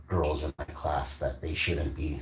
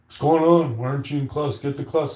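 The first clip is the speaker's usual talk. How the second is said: higher, louder rapid-fire demand; scolding principal; 9 semitones higher, 7 dB louder than usual. Retold or imitated. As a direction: imitate a principal ordering a student. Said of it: "the principal's there. He's like".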